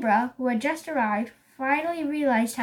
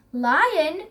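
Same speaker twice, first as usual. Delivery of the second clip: startled question, pitched up and louder; alarmed cry; 4 semitones higher, 5 dB louder than usual. A narrator's reading. Elephant reacts to the Lion's invitation, alarmed cry. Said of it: "cried Elephant".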